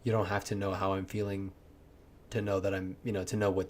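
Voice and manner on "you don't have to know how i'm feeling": ordinary delivery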